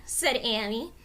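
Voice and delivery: ordinary delivery, nothing marked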